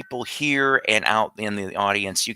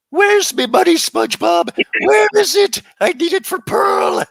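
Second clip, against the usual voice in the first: high, loud cartoon miser; shrieked, hammed-up cartoon voice; cartoon impression; 7 dB louder than usual. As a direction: squawk like a money-mad cartoon boss. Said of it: "here's my other one".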